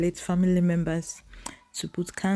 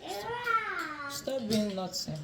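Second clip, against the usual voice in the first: high sing-song scold; talking to a dog; 7 semitones higher, 3 dB louder, much slower than usual. Real, not acted. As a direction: coax the naughty dog high.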